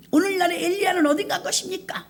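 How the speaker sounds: mock demanding. Preacher imitating the mother demanding of God, high mock protest. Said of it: "막 따졌대요".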